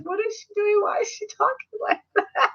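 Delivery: baffled. Retold or imitated. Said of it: "And I'm just like".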